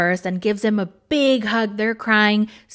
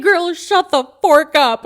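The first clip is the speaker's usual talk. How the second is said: loud, slow, higher-pitched scolding; exasperated telling-off; 6 semitones higher, 6 dB louder than usual. Acted. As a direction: tell her off with mock outrage. Scolding the tactless woman she discusses.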